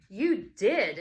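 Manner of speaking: put-on loud accusing child. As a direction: throw it back at her. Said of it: "accused Bradley".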